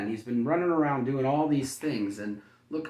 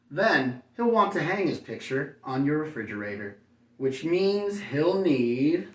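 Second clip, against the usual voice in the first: bright, lively storybook read, higher and louder; reading to children; 3 semitones higher, 6 dB louder, slower than usual. A narrator's reading. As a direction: read it like bedtime story to little kids. Reads picture book to children.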